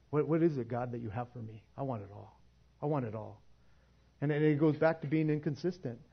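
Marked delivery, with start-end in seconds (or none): 0.1-3.4 quiet, softer pleading to God; hushed earnest prayer. Pretend. Hungry believer praying to God, hushed and earnest. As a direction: lean in and ask God softly. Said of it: "and saying".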